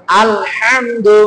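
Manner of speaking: slow "আলহামদু" with the ha from mid-throat; model throat pronunciation. Shown as a demonstration. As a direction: recite "আলহামদু" drawn out for pupils to copy. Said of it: "এরকমের উচ্চারণটা হবে".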